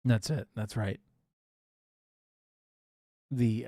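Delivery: ordinary talk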